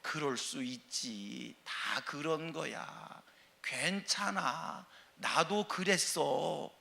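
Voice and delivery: slow, soft comforting words; gentle reassurance. Shown as a demonstration. Preacher modelling a believer's kind words, slow and soft. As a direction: soothe someone who failed, slowly and softly. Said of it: "예수 믿는 사람의 언어가 뭐예요?".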